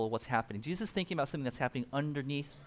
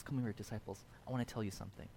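low, much softer call aside; quiet aside; 6 semitones lower, 12 dB quieter, faster than usual. Retold or imitated. Jesus calling disciples aside during the party, quiet. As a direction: pull them close and keep it hushed.

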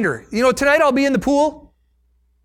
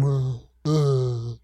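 mopey groan; 12 semitones lower, 6 dB quieter than usual. Retold or imitated. Acting a wallower.

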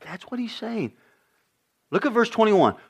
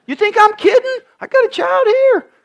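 mock kid voice; 8 dB louder than usual. Parent teasing kid in dialogue.

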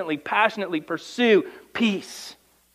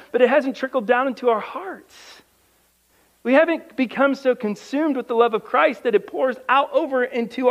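higher pleading exhortation; fervent preaching; 7 semitones higher than usual. Acted. Preacher pleading with his church, higher and fervent.

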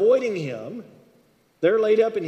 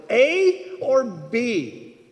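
much louder, much higher and very slow; insistent loud; 4 semitones higher, 5 dB louder, much slower than usual. Pretend. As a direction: drag out the choice as if to someone slow. Pushing God for an answer.

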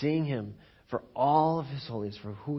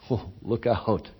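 ordinary talk

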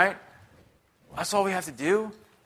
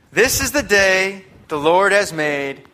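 scripture reading; 3 semitones higher, 5 dB louder, slower than usual. Acted. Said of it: "reads this".

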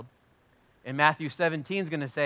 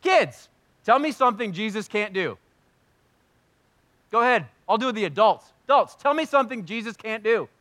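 loud, raised call to the congregation; calling out to the room; 8 semitones higher, 7 dB louder than usual. Real, not acted. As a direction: call out and invite answers. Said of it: "I'd say".

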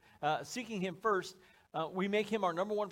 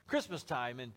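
ordinary talk

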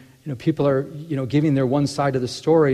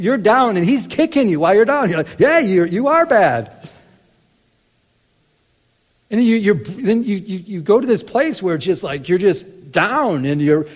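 higher and louder, pressing the point hard; full-force preaching; 9 semitones higher, 8 dB louder than usual. Acted. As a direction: preach it out to the congregation.

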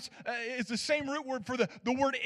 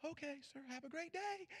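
soft polite brush-off; 4 semitones higher, 18 dB quieter than usual. Retold or imitated. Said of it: "and I'm like".

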